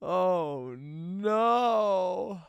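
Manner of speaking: long drawn-out rising wail; hammed-up slow horror; mock dread. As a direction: stretch it out in exaggerated dismay. Realizing he forgot the gift.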